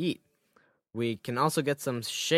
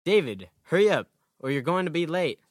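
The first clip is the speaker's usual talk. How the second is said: calling out louder, from downstairs; yelling across the room; 5 semitones higher, 6 dB louder than usual. Acted. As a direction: call up the stairs to him. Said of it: "my mom yelled from downstairs".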